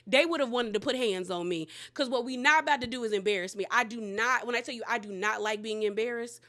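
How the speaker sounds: ordinary delivery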